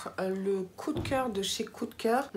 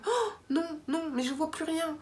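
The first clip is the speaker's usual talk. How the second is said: mock panic; 7 semitones higher than usual. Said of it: "J'étais là".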